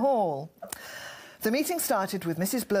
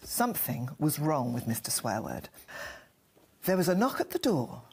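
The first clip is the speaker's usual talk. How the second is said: ordinary talk, nothing marked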